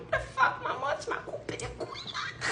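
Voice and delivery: high voice for the son, fading to laughter; a hint of an outraged boy; lightly voiced indignant son; imitating her son. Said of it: "il reste stoïque en mode".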